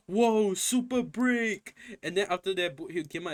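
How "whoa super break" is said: drawn-out, higher, louder fake gasp of awe; a slow, awed "whoa" mocking the hyped fans; mock hype. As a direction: slowly ham up the crowd's amazement. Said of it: "everyone is like".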